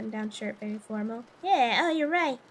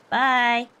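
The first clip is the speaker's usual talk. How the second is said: usual speaking voice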